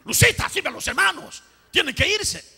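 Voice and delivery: loud, high bossy order to a wife; retold yell. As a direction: re-enact yelling at his wife with authority. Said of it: "me sentí mal de haberle gritado".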